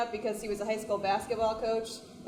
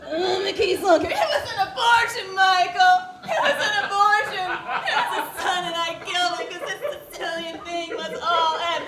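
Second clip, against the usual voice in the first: higher, louder hammed-up mafia shouting; mock Godfather yell; 7 semitones higher, 7 dB louder than usual. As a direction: bellow it like a Godfather melodrama. Godfather scene parody, yelling.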